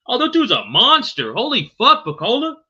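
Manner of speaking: high, loud, blown away; hyped reaction. Real, not acted. Streamer amazed by a fighter's knockout reel, loud and high. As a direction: cheer at the highlight like a fan.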